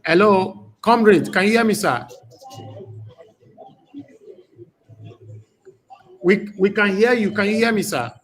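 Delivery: high, insistent hailing of a guest on the line; calling out. Real, not acted. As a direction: raise your voice to check the caller can hear. Calling guest on bad connection.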